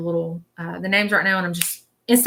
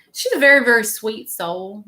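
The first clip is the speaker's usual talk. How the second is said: usual speaking voice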